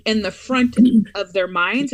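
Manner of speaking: ordinary talk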